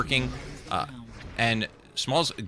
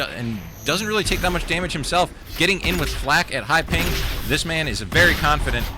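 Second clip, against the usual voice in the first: louder, excited esports casting; live play-by-play; 4 semitones higher, 3 dB louder than usual. Acted. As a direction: call the action with rising hype.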